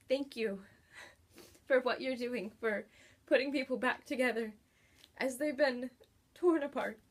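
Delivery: real tears rising, a laugh breaking through; choked up while thanking the author; teary thanks. Real, not acted. Reviewer thanking the author, voice catching with real tears. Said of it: "I'm crying".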